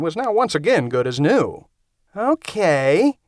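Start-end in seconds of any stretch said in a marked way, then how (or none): 2.2-3.2 Okay drawn out slowly, rising. Acted. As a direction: stretch the word out as if dealing with madness. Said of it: "said Tom in a very slow voice".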